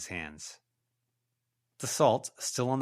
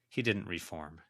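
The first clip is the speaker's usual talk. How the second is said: ordinary delivery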